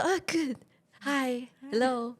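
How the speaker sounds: slow, halting greetings with gaps between words; tongue-tied beginner English. Retold or imitated. Recalling first video call with foreign boyfriend, tongue-tied. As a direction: imitate yourself groping for simple English words. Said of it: "我都讲不出口".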